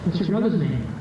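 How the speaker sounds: high cheeky French puppet voice; a sassy little dummy answering back; ventriloquist dummy. Acted. Ventriloquist banters with his French dummy Cecil, high puppet voice.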